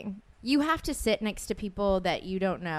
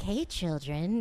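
slow, softer creepy ice cream man voice; 3 semitones lower, 4 dB quieter, slower than usual. Retold or imitated. Doorman likened to an ice cream man, slow creepy lure. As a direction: lure the kids in, slow and soft. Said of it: "it was like an ice cream man".

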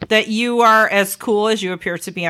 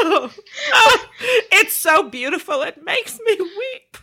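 laughing; 10 semitones higher than usual. Real, not acted. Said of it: "you're going to make me cry".

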